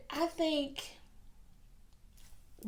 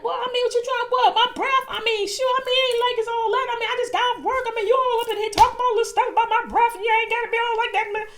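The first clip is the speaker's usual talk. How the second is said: loud, high, fast offended rant; angry rant; 10 semitones higher, 10 dB louder, much faster than usual. Retold or imitated. Date defending his bad breath, loud fast angry rant. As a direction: fire it back at her, fast and loud. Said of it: "he was pissed off".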